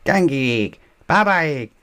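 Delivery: put-on high babbling toddler call; toddler shouting. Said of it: "shouted George".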